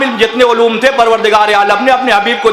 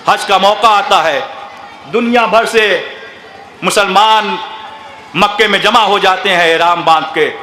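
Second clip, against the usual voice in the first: mournful majlis narration; 4 semitones lower than usual. Acted. Preacher recounting Husain's journey to Karbala to mourners, lamenting. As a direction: let the grief settle into a hushed recital.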